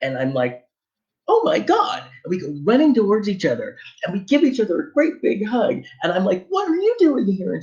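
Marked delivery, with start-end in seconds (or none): 1.3-2.0 a much higher squeal of delight; thrilled, high "oh my God"; overjoyed surprise. Retold or imitated. 6.5-7.5 much higher, delighted question; giddy, high-pitched greeting; excited greeting. Retold or imitated. Joyful meeting in the museum.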